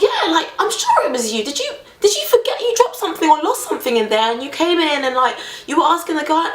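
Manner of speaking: imitating the driver. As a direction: certain he caught her. Driver insists it was her.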